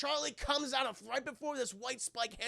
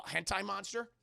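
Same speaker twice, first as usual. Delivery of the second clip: ordinary talk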